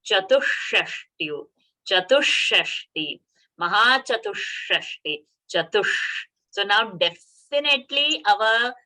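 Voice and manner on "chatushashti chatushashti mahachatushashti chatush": "Chatushashti" slowly, with a doubled, held retroflex sh; teaching demo of "Chatushashti" with a long hushing sh; extra sh. Shown as a demonstration. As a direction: linger on the sh in "Chatushashti". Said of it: "sh extra pronunciation".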